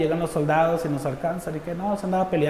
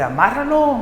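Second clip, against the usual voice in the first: higher-pitched mock call-out; retold command; 9 semitones higher than usual. Another attacker orders him tied up, retold command. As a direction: imitate someone calling out an order, pitched up.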